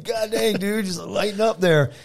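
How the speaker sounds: high, strained grumble; mock pained complaint. Hurt wrestler to friend in the ring, pained.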